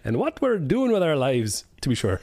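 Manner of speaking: swooping mock Irish intro; put-on Irish accent. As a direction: read the show intro with an Irish lilt. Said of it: "I'll do Irish".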